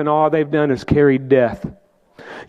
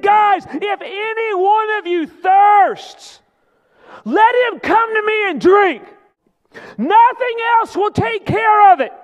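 high shouted call, slow on the first line; crying out. Acted. Preacher voicing Jesus calling the thirsty, shouted. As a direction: cry out to the thirsty crowd. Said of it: "he cried out to them, and he said".

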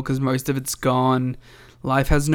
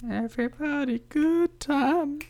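high, soft silly voice; mock high voice; 13 semitones higher, 4 dB quieter than usual. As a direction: answer in a high goofy voice. Mock singing answer.

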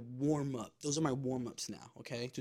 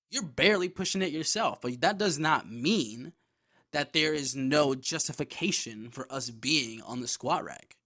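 louder, slow emphatic retort; indignant rebuttal; 3 semitones higher, 6 dB louder than usual. Retold or imitated. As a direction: hammer out the argument, acting out indignation. Imagined angry rebuttal to gym guy.

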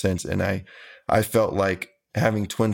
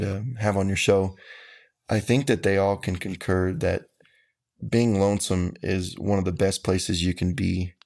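normal talking voice

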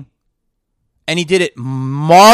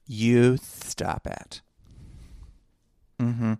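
slow, lower, drawn-out sassy scold; camp character voice; 8 semitones lower, 9 dB quieter, much slower than usual. Acted. Comedian voicing a camp character, slow and drawn out. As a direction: stretch it out like a camp diva telling someone off. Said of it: "a character that talks like this".

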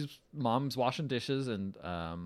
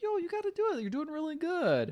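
high-pitched, acted pep talk; eager encouragement. Imitating a character urging a girl to show her art, eager encouragement.

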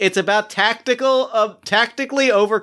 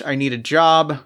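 ordinary talk

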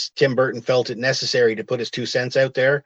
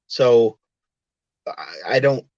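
normal talking voice

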